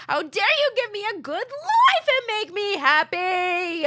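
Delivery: high, loud mock-indignant wail; mock outrage. Mock outrage at happy marriage.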